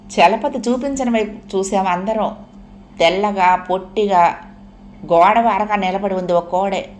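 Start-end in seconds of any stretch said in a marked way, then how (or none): none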